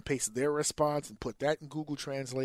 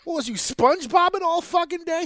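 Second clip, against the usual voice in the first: high, loud incredulous rant; loud, high mock outrage; exasperated outburst; 9 dB louder than usual. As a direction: demand answers from the lazy teacher. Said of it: "you look at the other teacher like".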